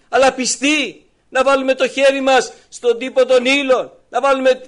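loud, high, impassioned sermon voice; fervent preaching. Acted. Preacher to congregation, voicing Mary and Jesus, loud and fervent. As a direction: preach it out to the whole church.

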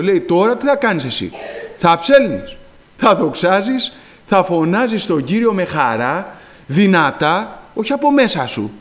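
loud, high, rousing preaching; fervent preaching. Acted. Preacher urging the brothers to praise aloud, fervent shout. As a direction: urge them to sing out loud.

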